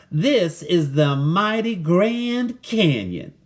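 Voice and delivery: put-on a friendly cowgirl greeting the boy, slow. Cowgirl shows the canyon.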